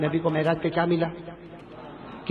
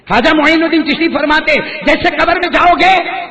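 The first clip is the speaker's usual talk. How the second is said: dramatic shout; 9 semitones higher, 10 dB louder than usual. Real, not acted. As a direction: hammer the warning home. Preacher's dramatic climax. (جوش میں اونچی آواز سے وعظ)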